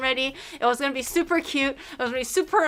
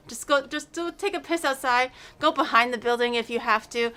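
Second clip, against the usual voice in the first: ordinary talk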